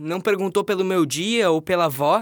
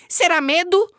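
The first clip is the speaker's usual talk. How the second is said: high cheeky doll voice; Emília doll voice; 11 semitones higher, 4 dB louder than usual. Acted. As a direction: play the cheeky rag doll. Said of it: "disse a boneca".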